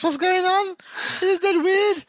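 high, squeaky disbelieving voice; incredulous disbelief. Real, not acted. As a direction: pitch it way up, outraged and baffled. Incredulous rant about the episode.

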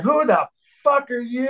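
high, affronted imitation of a punk singer; indignant challenge. Punk singer confronting an unknown drummer, indignant. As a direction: challenge a stranger with mock outrage.

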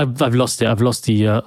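ordinary talk, nothing marked

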